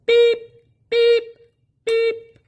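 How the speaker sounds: high, loud honking beeps; tooting horn sound, high and loud. Acted. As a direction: beep like a truck horn. Big rigs honking down street.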